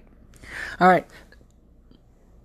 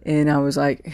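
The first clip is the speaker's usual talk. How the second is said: ordinary talk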